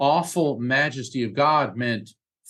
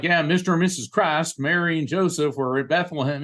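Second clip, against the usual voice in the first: higher-pitched mock Southern accent; 4 semitones higher, faster than usual. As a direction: put on a Southern accent for clueless churchgoers. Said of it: "I don't mean to affect a Southern accent".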